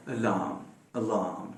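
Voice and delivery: अलार्म softly, first a nearly gone; quiet refined अलार्म; very short first vowel. Shown as a demonstration. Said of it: "और छोटा करना चाहें".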